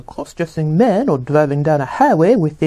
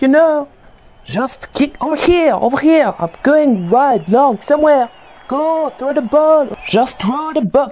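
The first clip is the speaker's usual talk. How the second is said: high shouting player; calling for the ball; 9 semitones higher than usual. Pretend. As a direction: pretend to be open on the field.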